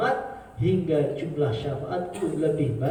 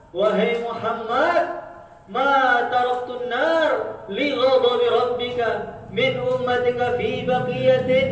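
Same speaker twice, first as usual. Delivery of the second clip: loud, high, slow Arabic proclamation; angel calling out; 6 semitones higher, 5 dB louder, slower than usual. Acted. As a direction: boom it out slowly like the gatekeeper angel. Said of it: "Malaikat Malik mengatakan".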